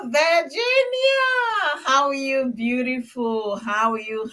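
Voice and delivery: Virginia sung out high and stretched slow; delighted greeting. Real, not acted. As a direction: greet the viewer who just joined with real delight. Spots a viewer joining live.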